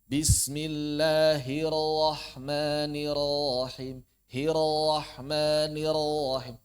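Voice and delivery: slow recitation, "Hirrahmanirrahim" with slack gliding Rs; tongue released early. Shown as a demonstration. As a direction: chant "Bismillahirrahmanirrahim" releasing each R too soon. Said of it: "Itu tidak tepat". ("Hirrahmanirrahim" keliru, lidah terlepas sebelum ra)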